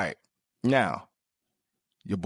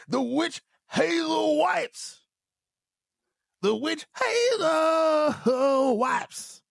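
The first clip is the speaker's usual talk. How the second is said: high, very slow hammed-up crying shout; mock wailing; much slower than usual. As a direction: wail the product name like a mock lament. Comic wail over the wipes.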